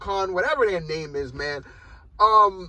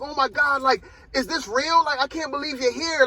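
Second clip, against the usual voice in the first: imitated awe, pitched higher; starstruck; 6 semitones higher than usual. Mocking how she greeted Shuri, starstruck. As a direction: mildly mimic a disbelieving fan. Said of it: "starstruck when you saw Shuri".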